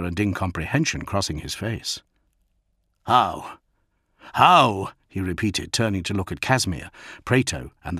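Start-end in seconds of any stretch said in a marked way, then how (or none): 3.0-4.9 rising angry demand. Acted. Lord demanding answers from his men, building anger. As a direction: press harder on the second how, fury building.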